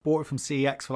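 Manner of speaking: normal talking voice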